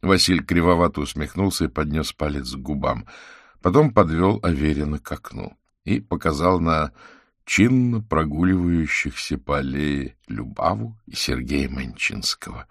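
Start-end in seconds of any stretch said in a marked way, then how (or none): none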